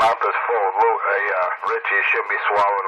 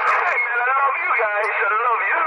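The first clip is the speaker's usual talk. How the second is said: falsetto; 13 semitones higher than usual. Pretend. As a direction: gush it in a high falsetto. Gushing falsetto on radio.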